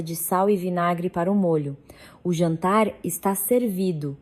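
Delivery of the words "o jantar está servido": ordinary delivery, nothing marked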